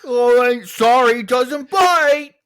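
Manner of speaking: high, loud dog owner's apology; called-out apology. Acted. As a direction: holler it slowly across to a stranger. Acted dog owner calling out apology.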